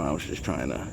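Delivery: ordinary delivery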